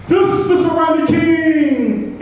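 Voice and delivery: high, loud, drawn-out crowd cry; angry shout. A narrator's reading. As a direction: shout it out slowly like an outraged protester. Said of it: "angry citizens shouted".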